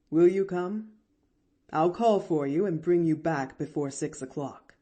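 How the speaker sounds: measured doctor character voice; genial doctor. A narrator's reading. Doctor inviting the girl along, genial. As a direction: explain kindly as the doctor.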